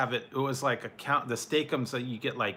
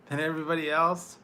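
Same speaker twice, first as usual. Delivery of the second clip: laughing; 5 semitones higher, faster than usual. Real, not acted. Laughs while teasing a chatter.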